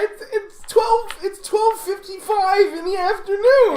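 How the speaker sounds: very high, laughing whine; John answering his friend; whiny John voice. Acted. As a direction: chirp it like a cheerful loser. John refuses a bar.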